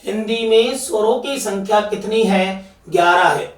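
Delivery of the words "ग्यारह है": ordinary talk, nothing marked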